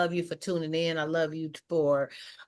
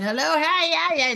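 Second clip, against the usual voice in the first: passersby greeting; 9 semitones higher than usual. Retold or imitated. Imitates everybody noisily greeting her.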